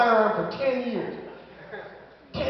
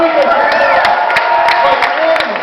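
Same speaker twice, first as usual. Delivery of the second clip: shouting, louder, higher; ranting shout; 4 semitones higher, 13 dB louder than usual. Real, not acted. Comic yelling over the cheering crowd, higher and louder.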